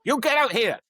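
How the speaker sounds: mock shout at a pupil, pitched high; retold teacher yell. Strict Thai teacher to a pupil, a mock loud yell. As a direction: bark it like a strict teacher ordering a kid out. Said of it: "and the teacher would go".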